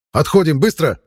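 loud, urgent order to retreat; shouted command. Acted. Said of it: "скомандовал Кислый".

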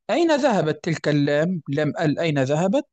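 ordinary talk

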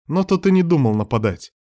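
ordinary delivery, nothing marked

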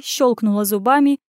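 ordinary delivery

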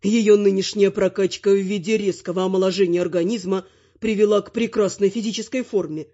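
plain reading voice

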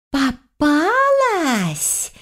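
high, long-drawn menacing taunt; hissing elf. A narrator's reading. Elf on crutches chasing her cornered prey, hissing taunt. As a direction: stretch the words out high, like a hunter taunting prey. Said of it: "зашипела эльфийка".

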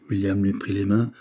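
plain reading voice

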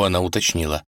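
ordinary delivery

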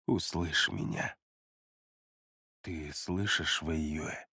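low, hushed and soothing; soft lulling voice. A narrator's reading. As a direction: lull him gently, almost whispering. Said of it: "он продолжил мягким, убаюкивающим голосом".

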